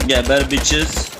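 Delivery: drawn-out, gloating jeer at the enemy; slow teasing trash talk; slow mock taunt. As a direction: stretch it out like a smug taunt. Taunting enemies in a shooter.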